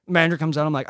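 ordinary talk

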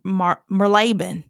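Marylebone as mur-LAY-bin, Southern style; Southern pronunciation. Shown as a demonstration. Reading a London name her Southern way, Marylebone as mur-LAY-bin. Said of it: "like, Southern accent".

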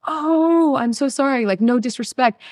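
apologetic. Retold or imitated. Acted apology to a stranger.

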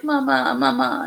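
mother mimics her crawling son calling mama; imitating baby calling. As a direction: call for mom like a clingy baby. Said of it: "And he was like".

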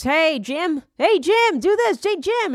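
high, insistent calling of Jim. Retold or imitated. Mimicking a boss who keeps calling Jim, high and insistent. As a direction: nag for Jim's attention, pitched high.

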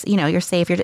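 usual speaking voice

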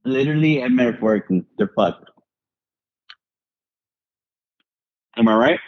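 talking with a mouthful of candy; chewing while talking. Real, not acted. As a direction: chew while you speak. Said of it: "Excuse my eating. I'm eating M and M's".

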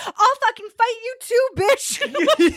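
high, laughing retold threat; mock challenge. Passenger challenging the angry man, high mock bravado. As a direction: squeal out a pretend fight challenge. Retells own shouted challenge.

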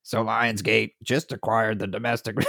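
flat robotic news read; monotone speech synthesizer voice; Stephen Hawking voice. Retold or imitated. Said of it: "in Stephen Hawking voice".

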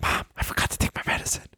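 hushed, hurried whisper to mom; urgent whisper. Acted. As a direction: whisper it fast like a secret signal. Urgently hinting to mom on phone.

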